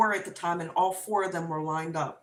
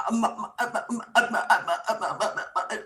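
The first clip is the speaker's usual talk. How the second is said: panicked stutter; 5 dB louder than usual. Retold or imitated. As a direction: stammer repeatedly, then burst out loud. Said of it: "I literally stuttered out the words".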